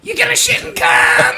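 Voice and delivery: high shrill taunting shout; Toad voice. Acted. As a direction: yell it like a squeaky toad. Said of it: "do the fucking Toad voice".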